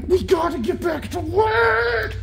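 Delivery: way up high and slow, playful; high sing-song repeat. Real, not acted. Owner telling her puppy playtime is over, high sing-song. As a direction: sing it to the puppy as a cheery call.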